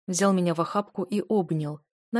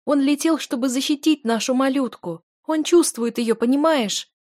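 high, loud insistence; heated pleading; 7 semitones higher, 5 dB louder than usual. A narrator's reading. Wife insisting to her husband, high and heated. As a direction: force him to understand.

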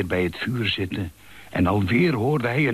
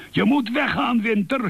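shouting. Acted. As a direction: yell him out of the house. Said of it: "schreeuwde Uil".

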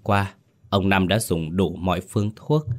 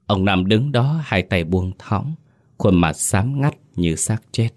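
ordinary delivery, nothing marked

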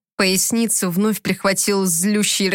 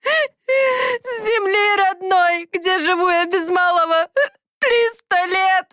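high, slow wailing cry; 10 semitones higher, slower than usual. A narrator's reading. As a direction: wail it out through sobs. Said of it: "всхлипывала она". (рыдающий крик)

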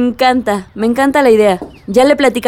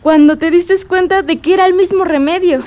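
ordinary talk

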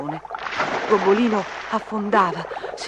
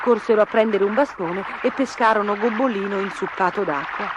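plain reading voice

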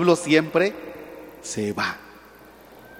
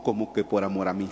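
doubting believer; 10 semitones lower, 8 dB quieter, faster than usual. Retold or imitated.

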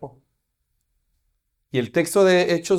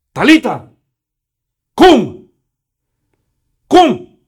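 loud, higher, rising call of Talita cum; loud command; 7 semitones higher, 13 dB louder, much slower than usual. Shown as a demonstration. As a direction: call the dead girl back with full force. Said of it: "Sino que le dice". (orden en voz alta: "Talita cum, cum")

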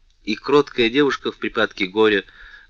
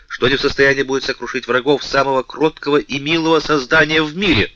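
rising fury, faster and louder; furious threat; 5 semitones higher, 4 dB louder than usual. A narrator's reading. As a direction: rage at her enemies, building. Furious king threatens vengeance.

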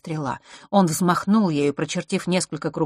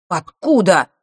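louder, snapped retort; snappish; 3 semitones higher, 7 dB louder, slower than usual. A narrator's reading. Boy rebuffs a question put to him, snappish. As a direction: snap back at once. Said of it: "тут же огрызнулся Марк".

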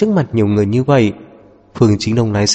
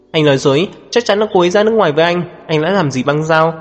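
higher-pitched, angry accusation; indignant; 6 semitones higher, faster than usual. A narrator's reading. Woman accusing man over missing friend, indignant. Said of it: "Đồng bội phẫn nộ nhìn Ngự Giao".

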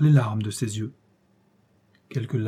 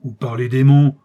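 loud royal oath, swelling in force; loud menacing cry; shouted threat; 8 dB louder than usual. A narrator's reading. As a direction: threaten as a bellowing monster. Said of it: "cria le monstre".

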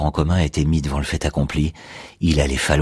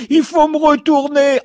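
pleurnicherie suraiguë et forte; gémir comme un four capricieux; four qui pleurniche; 6 dB louder than usual. Retold or imitated. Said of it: "en train de pleurnicher". (four qui pleurniche, voix suraiguë)

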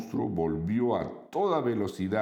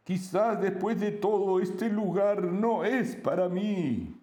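friendly monster voice; 13 semitones higher than usual. Acted. Monstruo habla tras quedar atrapado.

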